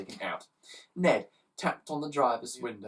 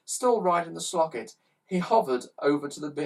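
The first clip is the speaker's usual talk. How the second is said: ordinary delivery, nothing marked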